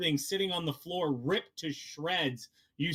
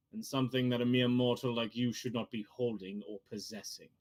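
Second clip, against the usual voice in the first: lower, measured voice of a scheming collector; mysterious NPC voice; 5 semitones lower than usual. Acted. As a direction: play him low and knowing, savoring each word. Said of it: "he turns to you and goes".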